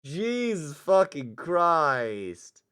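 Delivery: real disbelief, stretched slow and loud; drawn-out shocked yell. Real, not acted. Player shocked by a friend's line, drawn-out loud yell. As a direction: shout it out slowly in shock.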